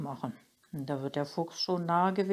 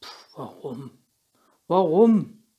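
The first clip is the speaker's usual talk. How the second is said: scoffing, then a loud grumbling warum; grumbled; 11 dB louder, slower than usual. A narrator's reading. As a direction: huff it like a grumpy grandpa. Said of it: "brummt Opa".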